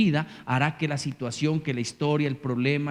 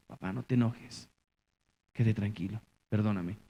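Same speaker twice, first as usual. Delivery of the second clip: gentle plea; 6 semitones lower, 5 dB quieter than usual. Retold or imitated. Child answering gently.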